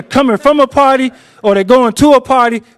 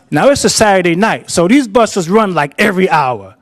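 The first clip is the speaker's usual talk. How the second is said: ordinary talk, nothing marked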